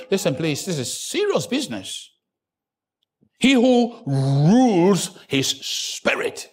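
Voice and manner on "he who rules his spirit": very slow weighty line. Shown as a demonstration. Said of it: "repeating key phrase".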